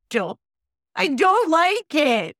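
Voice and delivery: high, loud, drawn-out protest; freaked-out whine. Real, not acted. Storyteller reacting to a haunted hanger, freaked-out wail.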